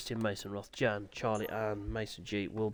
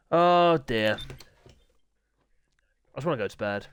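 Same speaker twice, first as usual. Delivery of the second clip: normal talking voice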